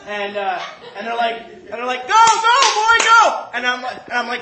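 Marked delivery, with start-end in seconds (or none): 2.1-3.5 high, very loud hurrying yell; urgent shout. Retold or imitated. Crew sending the jumper out, urgent yelling. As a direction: bellow at him to get moving.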